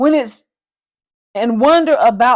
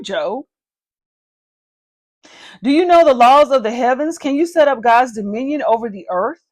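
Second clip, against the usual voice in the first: ordinary talk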